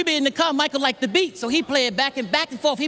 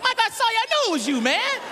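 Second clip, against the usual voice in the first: much higher gotcha; higher triumphant catch with his friend; 11 semitones higher than usual. Retold or imitated. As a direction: catch him red-handed. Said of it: "I said".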